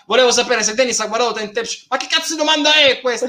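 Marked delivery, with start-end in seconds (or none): 1.9-3.3 exasperated. Real, not acted. Reacting to silly chat question.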